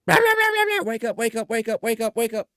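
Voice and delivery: high, loud, squawking nag; screaming harpy. Retold or imitated. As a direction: shriek it over a sleeping man. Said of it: "I got this screaming harpy in my ear".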